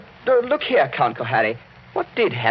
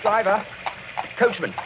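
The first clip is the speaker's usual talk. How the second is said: raised voice over the storm; calling out; 10 semitones higher than usual. Acted. Young man calls to the coachman, raised voice. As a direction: call up to the driver on his box.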